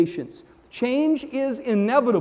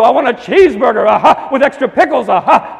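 loud mock preacher, aha after each phrase; hacking preacher; 3 semitones higher, 10 dB louder than usual. Ordering a cheeseburger in the hacking preacher voice. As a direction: order a burger like a radio preacher. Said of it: "you expect him to go".

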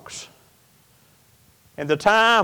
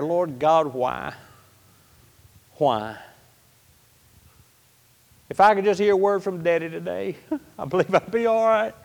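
quiet grieving prayer; 3 dB quieter than usual. Retold or imitated. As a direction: ask God why, almost under your breath. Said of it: "I went back into another prayer".

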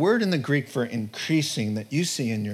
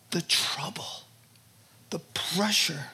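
soft unvoiced whisper; 5 dB quieter than usual. Real, not acted. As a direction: breathe the words out. Whispered sermon point.

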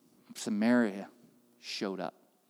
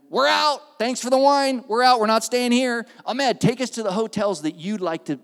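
loud, much higher indignant declaration; loud walkout; 9 semitones higher, 8 dB louder than usual. Retold or imitated. Pastor tells the hotel the group is leaving, loud. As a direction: announce you're leaving, loud and high.

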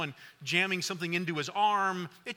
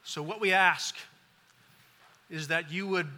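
earnest prayer; 2 semitones lower than usual. Acted. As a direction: pray for the congregation, unhurried. Closing prayer.

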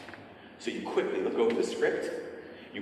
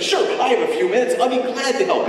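bright, keen, louder agreement; eager volunteer; 11 semitones higher, 6 dB louder than usual. Retold or imitated. Visitor eagerly offering to help the troupe, bright and keen. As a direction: jump at the chance to help. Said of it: "surge of civic pride".

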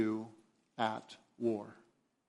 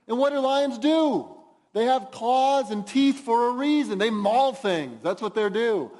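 louder, driving preacher voice; full-force preaching; 7 semitones higher, 5 dB louder than usual. Acted. Pastor warning the congregation about sin, loud forceful preaching. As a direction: hammer the point home from the pulpit.

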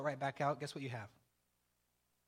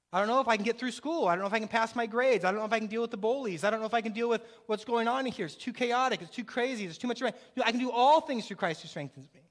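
rising preacher's cadence, a touch louder; preaching; 2 semitones higher, 4 dB louder than usual. Acted. Pastor rallies the congregation with a preaching build. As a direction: preach it with mounting conviction.

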